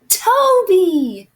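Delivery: high, loud, happy shout; delighted exclamation. Acted. Ben greets his friend Toby on the phone, delighted shout. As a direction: cry out the name, falling from high.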